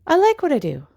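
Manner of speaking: high, emphatic, insistent; high insistent delight. Retold or imitated. Mom telling her family she loves her work, high and emphatic. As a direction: insist brightly you love it.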